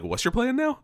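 squeaky high disbelieving question; mock incredulous. Viewer mocking Goliath's plan, high squeaky disbelief. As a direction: tease the lame plan in falsetto.

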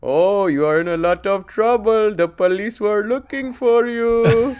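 high, loud mock Indian accent, laughing; teasing Indian accent. Clerk teasing the whistler, loud mock Indian accent. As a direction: rib him like the clerk. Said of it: "He goes".

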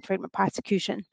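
ordinary talk

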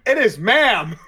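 loud, indignant "It is ma'am"; half-playful shout of correction; mock shout. [name] shouting her correction at Addie, mock loud. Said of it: "I could hear [name] shouting".

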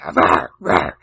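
deep growling demon voice. Pretend. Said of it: "the demon voice".

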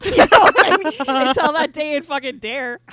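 loud laughing through the words; high, loud laugh in the voice. Real, not acted. Laughing hard at her memory.

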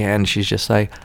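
normal talking voice

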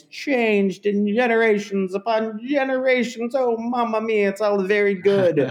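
high, lilting cartoon Italian; mock Italian accent. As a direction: gush in a hammed-up Italian accent. Parodies the film's cartoon Italian accent.

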